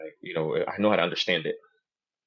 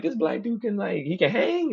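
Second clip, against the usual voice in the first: high impressed voice; 13 semitones higher, 3 dB louder than usual. Retold or imitated. Imitating others' thoughts.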